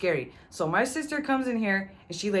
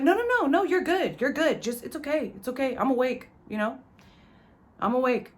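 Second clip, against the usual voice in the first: much higher, pleading and insistent; desperate reassurance; 7 semitones higher than usual. Retold or imitated. To his sister offering to leave: high pleading reassurance. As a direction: keep her from leaving at all costs.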